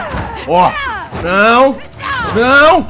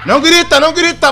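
urgent scolding; 9 semitones higher, 3 dB louder than usual. Real, not acted. Telling a screaming enemy to stop.

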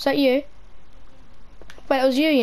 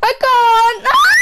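very high, loud panicked shriek; terrified scream; 12 semitones higher, 8 dB louder, slower than usual. Real, not acted. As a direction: break into a scream of fear. Scream in horror game.

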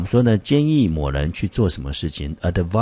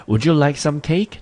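much higher, bright questioning offer of cake; lively offer; 10 semitones higher, 4 dB louder than usual. Shown as a demonstration. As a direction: act the host, pitched way up. Teacher reads dialogue line aloud.

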